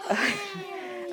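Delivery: high, delighted burst of laughter; cheering laugh. Acted. Crowd celebrates the cage not falling, joyful laughing cheer. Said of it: "Then they cheered".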